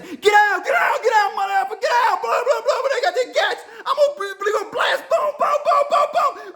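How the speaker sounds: very high, frantic mock yelling; hammed-up police screaming over each other; squealed, comic imitation of cops shouting. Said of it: "The cops saying".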